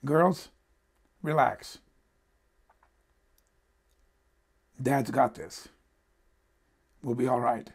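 low, soft and unhurried, with long pauses; calm reassurance. Retold or imitated. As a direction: reassure the kids as an unruffled mother. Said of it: "says calmly".

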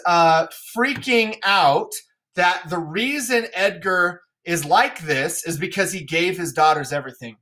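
normal talking voice

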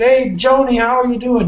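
high, bright sing-song hello, mildly acted; cheery greeting. Retelling greeting her first customer, high cheerful hello. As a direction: greet her like a chipper morning person.

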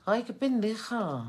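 high, loud mock scolding of herself; self-scolding. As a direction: call yourself an idiot in a high squeal. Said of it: "dejo de tratarme como". (regañándose a sí misma en tono agudo)

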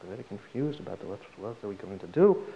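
low, quiet, hesitant question; acted nervous mumble; nervous boy. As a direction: ask the rabbi timidly, with small halts. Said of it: "very nervous".

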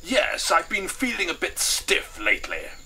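high, slow colonel voice; stiff old-school colonel. Acted. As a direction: play a colonel airing his complaint.

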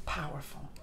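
hushed breathy whisper; awed whisper. Real, not acted. Vlogger after the fiery ending, whispering in awe. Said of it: "awed whisper".

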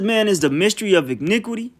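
usual speaking voice

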